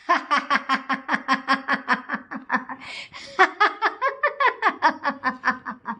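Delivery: long villainous cackle; evil cackle. Acted. Captor Ganesh gloating over the tied-up boys, evil cackle. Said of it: "गणेशनी आता एकदम विकट हास्य केले".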